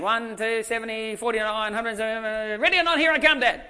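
put-on high, loud childish countdown; kids' playful counting. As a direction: count like a giggling kid. Kids counting in the game.

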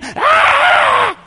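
high-pitched drawn-out "Ah" of panic; acted scream. Preacher imitating disciples caught in a massive storm, mock scream. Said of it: "grabbing the ropes and screaming".

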